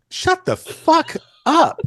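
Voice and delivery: high, loud, drawn-out mock yell; hammed-up indignant outburst, each word stretched; mock outrage. Photographer to admiring musician who called him a legend, mock outraged.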